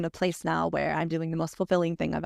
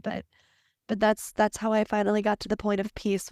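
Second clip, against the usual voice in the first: ordinary talk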